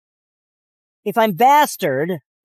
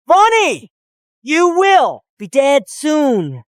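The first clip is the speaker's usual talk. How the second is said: enraged shout; slower than usual. Acted. Beaten man threatening Bonnie, enraged shout. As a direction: shout the name, then draw out the threat. Dragged away, screaming threats.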